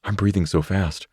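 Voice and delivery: ordinary delivery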